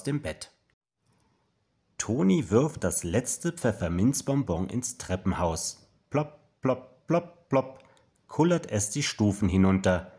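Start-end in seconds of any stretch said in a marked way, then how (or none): 6.1-7.8 high, popped plop noises; short, separate pitched-up plops; bouncing plops. A narrator's reading. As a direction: imitate a sweet hopping down stairs. Sweet bouncing down the stairs.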